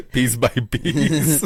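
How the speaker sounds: high, drawn-out words through laughter; laughing. Real, not acted. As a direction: stretch it out slowly while laughing. Laughing along with the joke.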